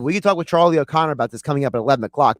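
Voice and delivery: quick, low promo patter; radio plug. Real, not acted. Host telling Bill what's coming up at eleven, brisk low plug. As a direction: rattle off the station plug, fast and low.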